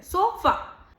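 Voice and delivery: सोफा with a quick, neutral final 'uh'; weak short ending. Shown as a demonstration. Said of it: "अ को बिल्कुल अ कह के जल्दी से बात खत्म कर देते हैं". (सोफा का आख़िरी 'अ' छोटा और कमज़ोर)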